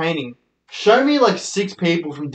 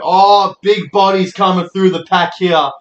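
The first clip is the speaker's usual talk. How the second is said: mock footy commentator; 4 semitones higher, 6 dB louder, slower than usual. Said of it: "the commentator's like".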